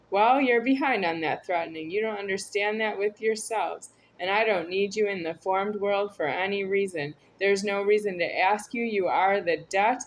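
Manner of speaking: usual speaking voice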